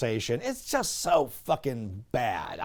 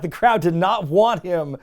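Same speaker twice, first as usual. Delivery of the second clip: ordinary talk, nothing marked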